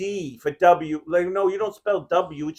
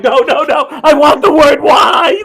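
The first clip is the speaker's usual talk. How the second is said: louder, higher, slowing down on the last words; exasperated protest; 6 semitones higher, 10 dB louder than usual. Retold or imitated. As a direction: insist at the machine in loud frustration. Arguing with the spell checker.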